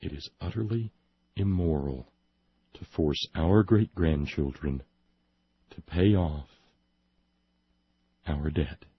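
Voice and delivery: low, measured reading with long pauses; sober, deliberate newsreader repeat; straight delivery. Shown as a demonstration. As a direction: let each phrase land, dead straight. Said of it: "delivered in any wise but straight".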